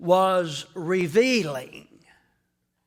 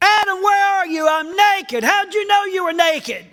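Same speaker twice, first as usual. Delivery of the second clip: high loud two-voice exchange; God and Adam exchange; 11 semitones higher, 8 dB louder than usual. Acted. Preacher acting God and Adam, loud exchange. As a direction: play both sides of the dialogue. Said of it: "God and Adam dialogue".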